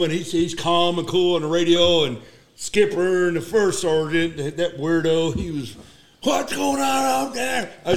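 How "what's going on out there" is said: high-pitched, drawn-out mock panic; shrill-pitched imitation of a panicked yell; panicked shout. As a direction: imitate someone shouting in alarm. Imitates the panicked weirdo on radio.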